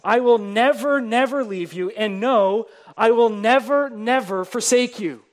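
each never pressed hard and loud; piled-up nevers. Shown as a demonstration. Preacher stacking the Greek negatives, loud emphatic stress. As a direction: hammer every never home. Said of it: "God here is piling up these negatives".

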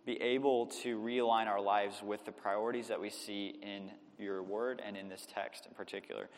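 low, subdued public prayer. Real, not acted. Pastor asking God to speak through the evening's text, prayerful. Closing an opening prayer before preaching.

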